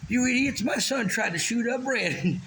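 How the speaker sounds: mock angry parent.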